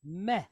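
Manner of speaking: "Meh" with a short open E; quick E sound. Shown as a demonstration. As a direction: say "Meh" as one brief syllable. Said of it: "the quick E sound".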